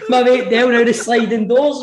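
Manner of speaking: ordinary talk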